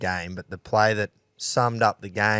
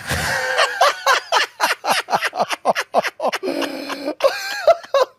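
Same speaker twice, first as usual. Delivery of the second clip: big laugh; 3 dB louder than usual. Real, not acted. Laughing at the old bloke.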